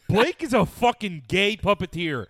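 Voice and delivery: high, loud exasperated outburst; loud protest. Real, not acted. Friend calling out Blake for teasing, loud and exasperated.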